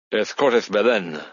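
put-on smooth, slow agency greeting in Spanish accent; very slick. A narrator's reading. Agency man answering a new caller, very slick. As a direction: answer the phone like a slick operator. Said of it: "answered in a very slick tone".

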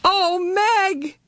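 put-on high child's cry; a small boy calling out in relief; little brother crying out to his sister. A narrator's reading. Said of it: "Charles Wallace was crying out".

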